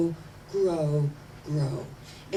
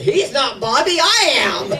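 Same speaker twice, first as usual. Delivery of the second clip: louder, higher, laughing through it; laughing punchline; 6 semitones higher, 12 dB louder than usual. Real, not acted. Preacher delivering the joke's punchline to congregation, laughing. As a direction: break up laughing at the joke.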